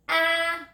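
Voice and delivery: 'Ah' held long on a high level note; short A sound. Shown as a demonstration. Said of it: "say ah".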